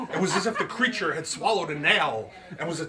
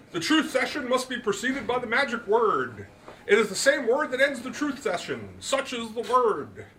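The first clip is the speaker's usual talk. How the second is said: put-on higher, louder, grand old gentleman; Root's voice; 10 semitones higher, 6 dB louder than usual. A narrator's reading. Root lectures his guest on the magic word, higher and grand. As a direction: pronounce it like a showman, slowing on the magic word.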